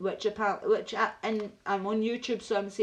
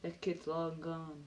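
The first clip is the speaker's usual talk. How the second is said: slow, soft echo of a film monologue; understated movie-line mimicry; Morgan Freeman; 3 semitones lower, 7 dB quieter, slower than usual. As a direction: lightly imitate a weary film speech, quietly. Echoing Morgan Freeman's film lines.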